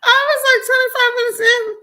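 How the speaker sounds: shrieking I was like twenty-five minutes in; high disbelieving squeal. Real, not acted.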